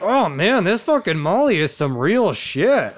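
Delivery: imitating a tight-jawed high; locked jaw. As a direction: squeeze the words past a locked jaw. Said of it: "saying it through a fucking locked jaw".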